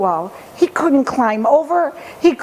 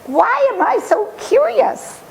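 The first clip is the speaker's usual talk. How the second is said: put-on raised-pitch boyish wondering; high curious boy; 12 semitones higher than usual. The boy asks himself, high and curious. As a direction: lift it high, puzzled and eager.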